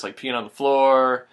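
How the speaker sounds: normal talking voice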